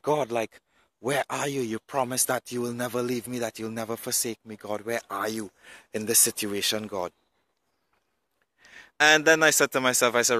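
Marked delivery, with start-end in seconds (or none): 0.0-7.1 hushed, much softer plea to God; a quiet, broken-down prayer; tearful prayer. Retold or imitated. Alone in his car, praying to God, softly tearful. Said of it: "I started to cry".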